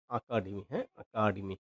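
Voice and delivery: একাডেমি with the first এ opened to an "a" sound, said softly; pronunciation demo. Shown as a demonstration. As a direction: say একাডেমি as "akademi", quiet and careful. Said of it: "এ এর উচ্চারণটা এভাবে হয়".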